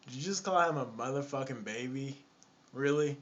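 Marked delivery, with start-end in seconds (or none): none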